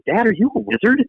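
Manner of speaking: put-on high-pitched kid asking in amazement; kids' wondering question. Kids asking dad about his robes, high-pitched wonder. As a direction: pipe up like a curious child. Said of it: "My kids would go".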